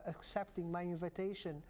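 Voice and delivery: ordinary delivery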